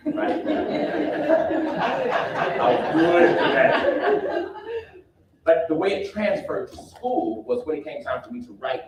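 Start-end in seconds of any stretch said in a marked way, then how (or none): 0.5-2.5 high laugh. Real, not acted. 2.6-4.5 amused, drawn-out laughing repeat. Real, not acted.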